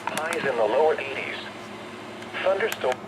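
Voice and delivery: ordinary delivery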